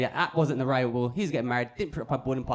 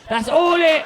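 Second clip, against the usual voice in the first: higher, loud repeat; emphatic build-up; 6 semitones higher, 7 dB louder, slower than usual. Acted. Comedian building to the one-word message, loud and emphatic. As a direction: belt it to set up the punchline.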